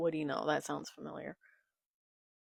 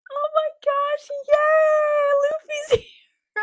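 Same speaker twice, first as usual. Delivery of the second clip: very high, loud, squealing delight; overjoyed squeal; 11 dB louder than usual. Real, not acted. Fan cheering Luffy's arrival, squealing with delight. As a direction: squeal it like your hero just walked in.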